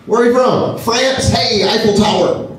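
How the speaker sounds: a high, shouty American naming a landmark; dopey American tourist. Retold or imitated. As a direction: blurt it out like a clueless tourist. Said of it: "what Americans like myself must sound like".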